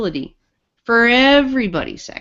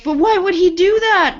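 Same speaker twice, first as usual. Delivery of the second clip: shocked coworker; 9 semitones higher, 4 dB louder than usual. Retold or imitated. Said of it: "And she's like".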